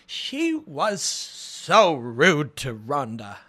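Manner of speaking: very slow Welsh accent, imitating mom. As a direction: drag it out in his mom's Welsh lilt. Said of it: "And my mom's like".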